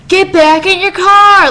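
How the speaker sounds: loud, slow mock shout of a guard; retold yell. Security guard yelling at fans to get back in their cars, loud mock shout. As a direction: bark the order like a fed-up guard.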